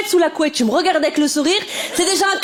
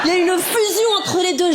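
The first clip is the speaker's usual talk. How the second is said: normal talking voice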